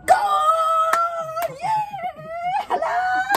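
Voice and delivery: high ecstatic goal shout; touchdown call. Pretend. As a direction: scream the goal in triumph.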